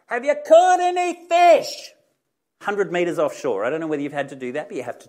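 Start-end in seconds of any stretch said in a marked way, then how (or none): 0.1-1.9 long, high-pitched holler across water; yelled out. Acted. Man on the beach to fishermen offshore, yelling. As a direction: yell it slowly to carry far. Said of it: "yells out to him".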